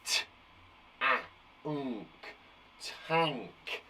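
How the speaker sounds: T, a, nk said one by one, then tank; segmenting sounds. Shown as a demonstration. Teacher modelling sound stamping for pupils. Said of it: "I'll go first, then you go".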